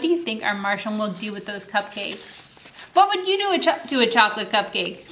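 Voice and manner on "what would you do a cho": ordinary delivery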